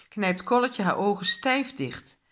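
plain reading voice

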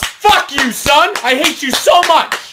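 dad yelling in fake rage; mock angry dad. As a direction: shout at the son.

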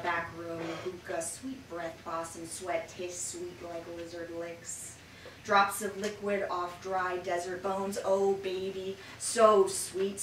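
sensual, rhythmic spoken word; slow sultry poem delivery; poetry reading. Acted. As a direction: savour every image, let it breathe.

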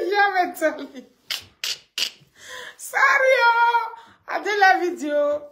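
high and loud through laughter; squealing with real laughter; laughing. Real, not acted. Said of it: "I said it when I was laughing".

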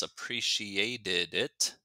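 "appreciated it" slow, with the -ted sounded; teacher's careful, slow "appreciated it"; careful past tense. Shown as a demonstration. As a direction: draw out "appreciated it" so the extra syllable is heard. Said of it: "in the past tense".

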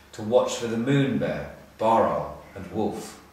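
ordinary delivery, nothing marked

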